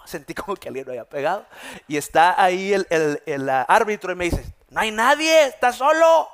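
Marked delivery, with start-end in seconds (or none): 4.7-6.3 higher, louder mock referee; referee's reply. Referee tells the fallen pastor no one touched him, raised voice. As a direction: play the ref telling him he's alone.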